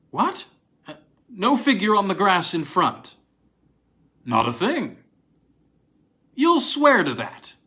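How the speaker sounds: sharp high disbelieving questions; startled. Acted. Williams hears there is no figure on the lawn, startled and high. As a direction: jolt up in disbelief.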